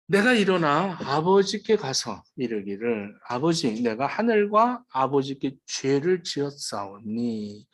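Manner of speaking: slow Bible reading of a confession; scripture reading. Acted. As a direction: read the son's confession slowly. Reads scripture aloud.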